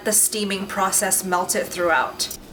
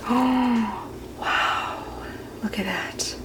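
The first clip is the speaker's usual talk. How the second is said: awed hushed whisper; 6 dB quieter, much slower than usual. Real, not acted. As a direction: breathe it out in awe. Awed whisper over the pudding.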